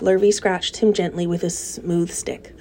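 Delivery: usual speaking voice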